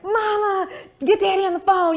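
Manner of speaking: high, loud, eager shout to mama; bursting to share the news; excited call. Retold or imitated. Daughter calling her mother with big news, excitedly.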